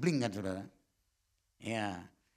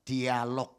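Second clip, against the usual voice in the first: normal talking voice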